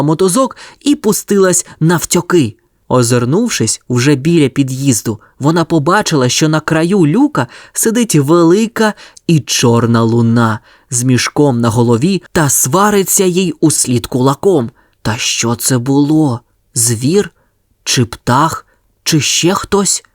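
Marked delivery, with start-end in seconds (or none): none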